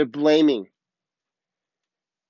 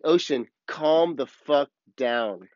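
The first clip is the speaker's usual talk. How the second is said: slow, emphatic order at usual pitch; drawn-out command; much slower than usual. Retold or imitated. Surfer tells the ocean off, in a slow drawn-out command. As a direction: stretch out each word as a retold command. Commanding the sea in retold moment.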